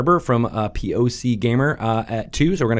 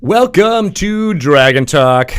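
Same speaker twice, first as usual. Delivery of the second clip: slow, louder announcer delivery; Shadow Stevens; 5 semitones higher, 5 dB louder, much slower than usual. Pretend. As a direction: stretch it out like a radio host. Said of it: "I was totally channeling Shadow Stevens".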